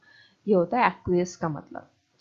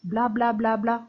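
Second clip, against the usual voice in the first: chatterbox blah-blah. Shown as a demonstration. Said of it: "मतलब बहुत ज़्यादा बोल रहा है।".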